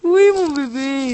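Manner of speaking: slow, loud cooing; doting baby talk. Pretend. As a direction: coo it like to a baby.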